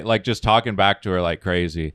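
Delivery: normal talking voice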